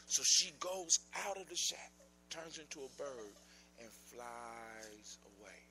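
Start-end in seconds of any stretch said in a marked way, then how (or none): none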